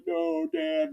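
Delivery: put-on a clinging child begging; mock toddler plea. Daughter clinging to shirt.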